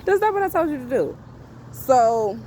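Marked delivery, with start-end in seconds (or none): 0.0-1.2 scolding repeat. Shown as a demonstration.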